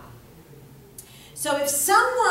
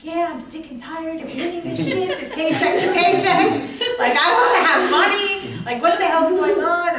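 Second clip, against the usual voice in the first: raised, loud fed-up venting; acted frustration; 4 semitones higher, 6 dB louder than usual. Client finally admitting money troubles, frustrated outburst. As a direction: let it burst out louder and higher.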